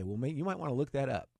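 ordinary delivery

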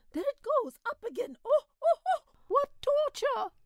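high, anguished cries; high tortured howling; crying out in pain. A narrator's reading. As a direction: wail as the bug crawls in your head. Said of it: "began to cry out again".

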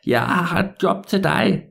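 put-on high, drawn-out old man's voice. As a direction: play the old man slowly handing out a job. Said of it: "den gamle mand, han var sådan".

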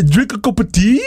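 playful attempt at an English accent; mock English accent. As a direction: put on a joking English accent. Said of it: "c'est ton accent anglais".

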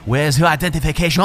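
hammed-up French policeman; exaggerated French accent. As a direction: demand it in an overdone French accent. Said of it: "I'm over-exaggerating his accent a little bit".

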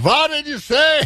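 Irish bartender scandalized, climbing high on say; Irish accent. Retold or imitated. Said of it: "in an Irish accent".

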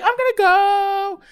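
high, slow I'm gonna go; slow awkward exit line; girl leaving. Retold or imitated. Trish leaving the stoner garage, awkward and slow. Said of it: "she's like".

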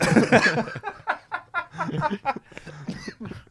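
real long, high laughing fit. Real, not acted. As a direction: crack up helplessly at your joke. Cracking up at own joke.

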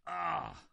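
indignant "ah". Retold or imitated. Unclean spirit cries out at Jesus, indignant. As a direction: burst out with a disapproving ah. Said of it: "is an expression of indignation".

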